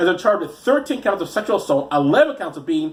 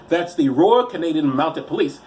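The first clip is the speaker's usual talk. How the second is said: ordinary delivery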